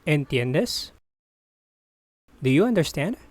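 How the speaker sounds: ordinary delivery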